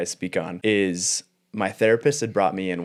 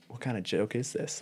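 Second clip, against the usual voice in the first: whispered aside; 3 semitones higher, 8 dB quieter than usual. Retold or imitated. Retold inner thought in therapy.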